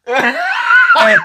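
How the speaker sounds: high laughing shout breaking through the quote; a loud squeal of laughter cutting off the retold line; laughing shriek. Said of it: "she goes".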